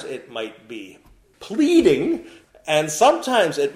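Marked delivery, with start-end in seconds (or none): 1.4-2.3 pleading. Acted. Sermon listing kinds of exhortation.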